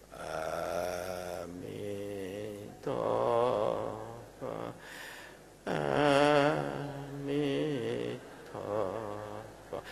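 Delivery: painfully drawn-out, hushed chant; too slow. Shown as a demonstration. Demonstrating deathbed chanting gone too slow, soft and dragging. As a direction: stretch each syllable feebly with long gaps. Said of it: "哎，不能太慢".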